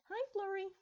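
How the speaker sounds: put-on high sing-song baby voice; baby talk. Twilight greeting her baby niece, high cooing. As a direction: coo it to a little foal.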